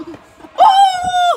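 loud high ooh; excited yell. Real, not acted. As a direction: whoop it out loud. Kid yelling.

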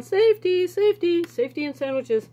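high, loud freaked-out repeating; mock panic from the film; panicked movie quote. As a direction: squeal it like a man freaking out. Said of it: "And he's going".

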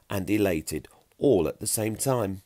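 usual speaking voice

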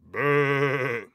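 long bleat from a big goat; drawn-out storybook goat bleat. A narrator's reading. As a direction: bleat like the biggest billy goat. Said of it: "said the biggest billy goat gruff brother".

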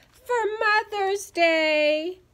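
high, loud, drawn-out whimper; tearful wail. A narrator's reading. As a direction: wail slowly like a let-down child. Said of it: "Ivy and Hackett started to cry".

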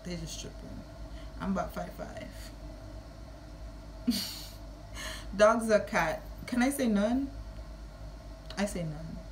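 ordinary talk

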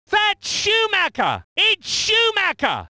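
high, exclaimed identification; excited race call. Acted. Commentator hailing Schumacher, high excited call. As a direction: ring the surname out high.

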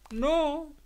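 a high, lightly voiced refusal; a small, firm no; defiant girl. A narrator's reading. Daughter refuses to name the father, high and lightly voiced. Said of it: "Y yo dije".

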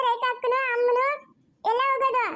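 normal talking voice

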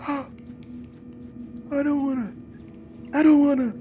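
small high dreading whine; high-pitched, gasping reluctance; reluctant whine. Real, not acted. Player dreading the next room, reluctant whine.

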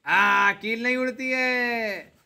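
drawn-out mock correction; slow teasing. Real, not acted. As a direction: stretch it out, amused. Mock-correcting a child's slip.

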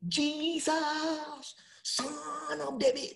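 a high, drawn-out plea called out to Jesus; crying out. Retold or imitated. Blind beggar calls out to Jesus, high and drawn out. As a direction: cry it out like a desperate beggar. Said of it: "He cried him out".

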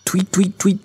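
high, quick owl tweets; a hopeful little string of high tweets; owl calling out. A narrator's reading. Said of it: "called Olive, trying again".

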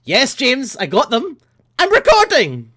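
high, loud, exasperated; exasperated mock reply. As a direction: snap back at the pesky friend. Exasperated reply to messaging friend.

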